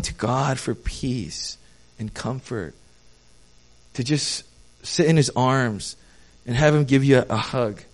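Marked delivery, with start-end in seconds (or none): none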